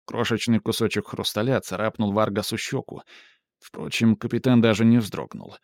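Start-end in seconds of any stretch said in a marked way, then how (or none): none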